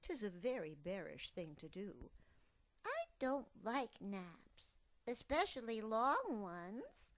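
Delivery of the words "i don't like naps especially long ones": high, slow pouting cub; sulky cub. Acted. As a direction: drag out the complaint. Cub protests.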